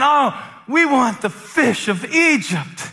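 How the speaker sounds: high, whiny mock lament; hammed-up complaining. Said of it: "they started complaining".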